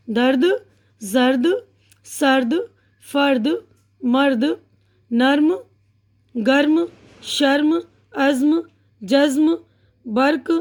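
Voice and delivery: each word alone with a pause after; unhurried word-by-word reading; slow and careful. Shown as a demonstration. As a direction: read slowly, one word at a time. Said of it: "मैं पहले थोड़ा इत्मीनान से पढ़ के".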